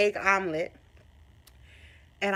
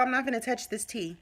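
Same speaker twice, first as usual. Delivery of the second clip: ordinary talk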